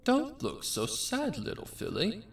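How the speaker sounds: dazed cult leader voice; creepy cult leader. Acted. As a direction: coo at her like a dazed madman. Cult voice talking to captive filly.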